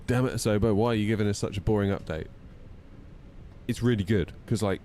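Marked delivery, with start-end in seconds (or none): none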